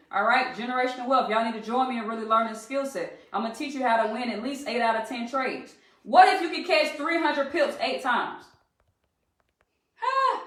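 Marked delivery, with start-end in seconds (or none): 6.0-10.4 loud, high, drawn-out rhetorical question; emphatic challenge. Real, not acted. Trader challenging viewers to imagine big wins, emphatic challenge. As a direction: hit them with it, loud and high, slowly.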